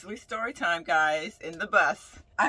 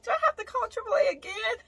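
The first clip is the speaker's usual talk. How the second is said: whiny wail; 14 semitones higher, faster than usual. Retold or imitated. Whining about calling for help again.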